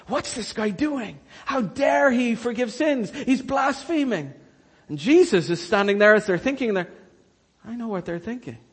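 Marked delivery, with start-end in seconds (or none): 0.1-4.3 scandalised onlookers. Acted. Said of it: "only thinking in their heads".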